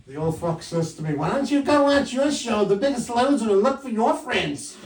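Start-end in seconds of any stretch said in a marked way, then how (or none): 1.1-4.8 put-on high, loud old lady comeback; feisty retort. Mother-in-law snaps back at son-in-law, high hammed-up retort.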